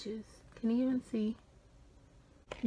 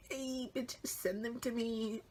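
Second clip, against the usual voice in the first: hushed conspiratorial whisper, slower at the end; sneaky whisper; 7 dB quieter, slower than usual. Retold or imitated. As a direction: whisper it so nobody else hears. Secret request to her sister.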